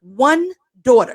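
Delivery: slow stress on "One daughter"; "One daughter" drawn out firmly; emphatic. Real, not acted. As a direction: weigh each word of "One daughter". Stressing a repeated point.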